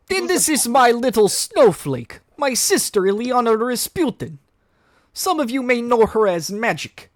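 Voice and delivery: fond introduction of little sister; Colossus voice. Acted.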